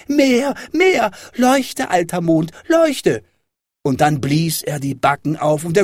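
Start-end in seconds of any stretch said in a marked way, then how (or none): 0.1-3.2 put-on very high child's clamouring; shrieked, high-pitched insistence; demanding shout. A narrator's reading. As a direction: cry out orders like a greedy little boy. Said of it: "schrie Häwelmann".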